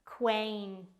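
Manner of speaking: "Quaint" stretched, with the final t set apart; careful teaching demo of "Quaint"; drawn-out pronunciation. Shown as a demonstration. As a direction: sound out "Quaint" slowly. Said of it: "It sounds like this".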